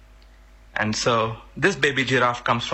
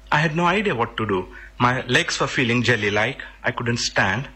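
ordinary delivery

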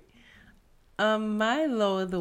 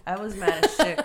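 laughing; 7 dB louder than usual. Real, not acted. Admits anger through laughter.

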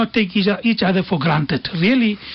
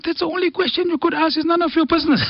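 retelling the father's sharp, higher-pitched retort; a touch of offended dad, voiced higher; indignant father; 6 semitones higher than usual. Father rebuffs his son's question about pay, indignant and higher. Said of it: "father was even more furious".

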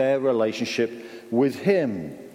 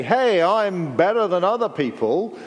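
higher-pitched, swaggering boast; mock prideful; 5 semitones higher, 3 dB louder than usual. Preacher voicing the attitude called pride, mock boastful. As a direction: put on a superior, show-off voice. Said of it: "that attitude where you say".

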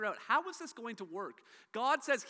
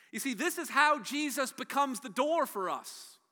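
preaching, louder and higher as it builds; preaching building; 4 semitones higher, 7 dB louder than usual. Acted. Preacher building to the sermon climax before the congregation, rising and louder. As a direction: drive toward the climax, rising.

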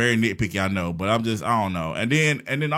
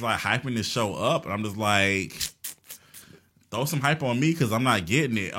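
ordinary talk, nothing marked

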